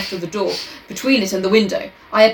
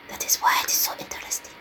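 whispered French-accented detective; softly; 9 dB quieter, faster than usual. Shown as a demonstration. Redoing the detective's line as softly added, whispered. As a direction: drop to a quick, creepy whisper. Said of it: "he added softly".

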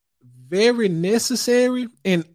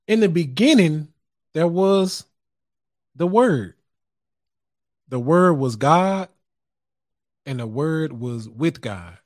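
scripture reading; 3 semitones lower than usual. Shown as a demonstration. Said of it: "it reads in the Bible".

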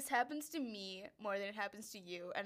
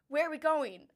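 stifled giggles; 6 semitones higher, 7 dB louder than usual. Real, not acted. Quoting him mid-laughter.

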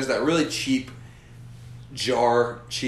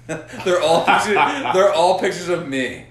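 high, loud and slow through laughter; laughing; 7 semitones higher, 6 dB louder, slower than usual. Real, not acted. Laughing at own fat photos.

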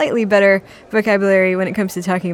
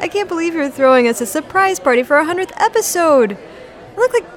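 excited; 8 semitones higher than usual. Acted. Host greets imaginary party guests.

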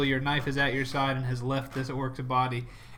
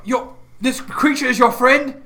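raised, higher voice; loud challenge; 8 semitones higher, 8 dB louder than usual. Acted. Talazar questioning Jack about the dead orc mage, loud challenge. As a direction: challenge him loudly. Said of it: "And so she says".

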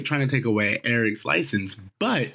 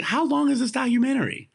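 high-pitched, incredulous question; incredulous reaction; 12 semitones higher than usual. Retold or imitated. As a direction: act out disbelief, pitch way up. Own exasperated reaction, acted out.

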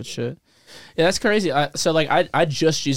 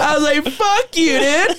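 mock outburst; 11 semitones higher, 4 dB louder, slower than usual. Mock retort, laughing.

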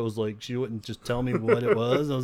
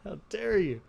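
a playful, high, offended How dare you; mock indignation; 8 semitones higher, slower than usual. Joking about his wife, mock offended tone. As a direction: pretend to be scandalised, lightly.

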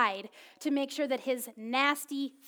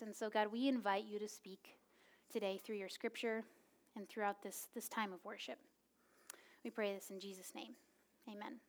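prayer; 2 semitones lower, 6 dB quieter than usual. Acted. Pastor opening the service in quiet prayer. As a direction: pray quietly over the congregation.

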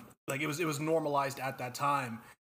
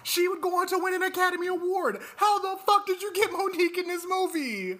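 much higher and a bit louder, incredulous; outraged high-pitched rant; 4 dB louder than usual. Real, not acted. Friend outraged an Oscar winner took the role, high incredulous squeal. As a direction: squeal it out in disbelief that she's in this movie.

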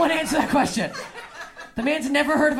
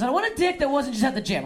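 ordinary talk, nothing marked

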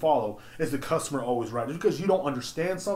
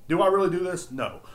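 ordinary talk, nothing marked

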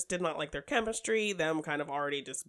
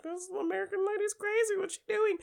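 very high, light mock voice; squeaky-high mock bewilderment; scandalized onlookers. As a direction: imitate onlookers gossiping in a high-pitched voice. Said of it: "everyone else is like".

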